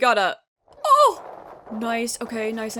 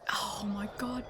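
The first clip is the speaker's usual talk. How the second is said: whisper; 4 semitones lower, 10 dB quieter than usual. Real, not acted. Tense whispered reaction to game.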